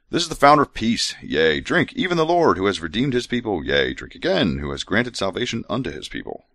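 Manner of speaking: measured scripture recital; scripture reading. Acted. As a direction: read it like holy writ. Reads scripture aloud.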